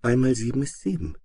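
light, singsong princess voice; little girl reciting sweetly. A narrator's reading. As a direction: recite the times table like songbird. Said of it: "es klang, als sänge eine Nachtigall".

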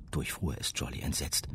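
ordinary delivery

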